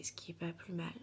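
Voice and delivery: whispered aside to herself. Real, not acted. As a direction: slip it in under your breath. Quiet aside about French version.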